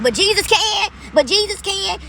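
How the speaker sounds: high, loud exclamation, caught up in faith; excited outcry. Real, not acted. To her viewers about Jesus, a loud high excited outcry. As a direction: let it burst out high and loud.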